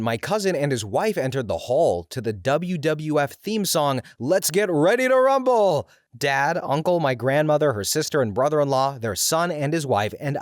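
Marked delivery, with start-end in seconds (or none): 4.2-5.9 high, loud fight announcer shout; hyped-up wrestling intro; ring announcer call. Retold or imitated. Theme song title called like announcer.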